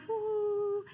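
long high sustained whoop; retold whoop of being on a cloud; elated whoo. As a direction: let out a floating, high "whoo". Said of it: "I just was like".

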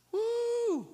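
high, drawn-out "woo"; high preacher's whoop of delight; excited whoop. Real, not acted. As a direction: let out a long high-pitched whoop. Excited preacher whoops between points.

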